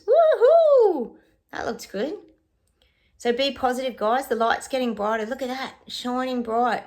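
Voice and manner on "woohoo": high, loud woohoo; excited whoop. Real, not acted. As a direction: cheer it out joyfully. Celebrating good card.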